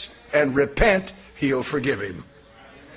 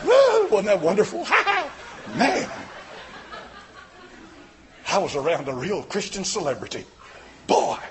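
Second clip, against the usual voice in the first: mock giddy fan. Preacher mocking churchgoers who meet a celebrity, giddy mock squeal. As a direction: gush like a starstruck fan. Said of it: "just being giddy all day long".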